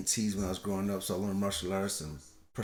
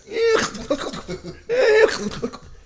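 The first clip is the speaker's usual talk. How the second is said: loud high laughter; 11 dB louder than usual. Real, not acted. Laughing retelling baby crying.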